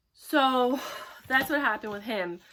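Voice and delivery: ordinary talk, nothing marked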